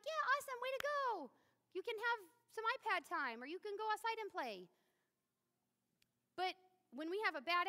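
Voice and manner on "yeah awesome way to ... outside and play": put-on mom cheering kids who did dishes gladly; excited mom. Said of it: "I'm like".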